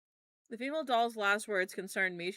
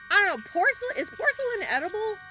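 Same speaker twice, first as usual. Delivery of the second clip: much higher, louder disbelief; incredulous; 11 semitones higher, 6 dB louder than usual. Real, not acted. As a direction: balk at eating porcelain. Incredulous about eating porcelain.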